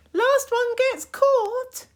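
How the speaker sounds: very high slow call; a daring game call. Acted. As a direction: sing it out like a dare. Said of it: "game call, retaken".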